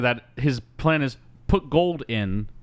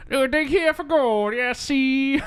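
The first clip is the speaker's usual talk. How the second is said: very high, put-on villain voice; hammed-up villain egging someone on, pitched way up; scheming villain; 13 semitones higher, slower than usual. Villain telling the prospector where to dig, high hammed-up scheming. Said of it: "and tell them".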